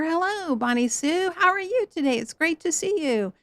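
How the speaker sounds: high, sugary singsong greeting; chirpy church lady. Retold or imitated. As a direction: gush over her like a church lady.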